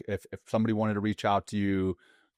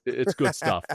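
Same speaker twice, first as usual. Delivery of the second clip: laughing; 5 semitones higher, 3 dB louder than usual. Retold or imitated. Echoing Wim Hof, laughing.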